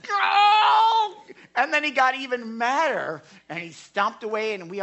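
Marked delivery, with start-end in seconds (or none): 0.1-1.1 very high, loud drawn-out yell; imitated enraged scream; furious yell. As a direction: let out an exploding mock roar of rage. Angry man's outburst, acted out.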